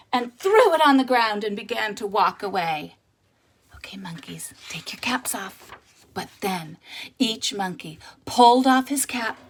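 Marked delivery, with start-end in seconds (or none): 3.8-5.7 whispered aside. Shown as a demonstration. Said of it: "prompting the child".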